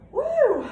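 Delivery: loud high whoop of triumph; triumphant whoop. Real, not acted. Cheer after finishing jumping jacks.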